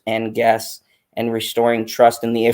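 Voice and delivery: words dissolving into a laugh; can't hold back the laughter; cracking up mid-sentence. Real, not acted.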